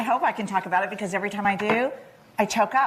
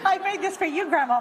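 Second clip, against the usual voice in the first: granddaughter's voice; 9 semitones higher than usual. Retold or imitated. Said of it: "she was saying to herself".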